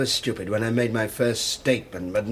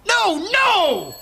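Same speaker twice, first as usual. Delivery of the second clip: very high, loud cry of denial; breaking-point shout; anguished scream; 6 dB louder than usual. Acted. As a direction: scream your denial at the accuser. Suspect breaks down under accusation.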